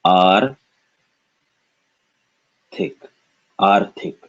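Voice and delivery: "Ar, tik" broken into two separate syllables. Shown as a demonstration. Teacher showing where to break the word, syllable by syllable. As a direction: sound out "Ar", pause, then "tik". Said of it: "we break it right where the conjunct is".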